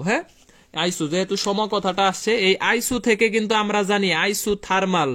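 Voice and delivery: ordinary talk, nothing marked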